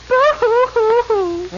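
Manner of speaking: mock sobbing. Mock crying to the prince.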